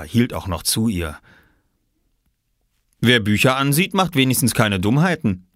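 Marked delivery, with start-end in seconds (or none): 3.0-5.5 lofty, self-satisfied dad voice, a bit louder; grand pronouncement. A narrator's reading. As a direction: declare it like a pompous father. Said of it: "stellte er großartig fest".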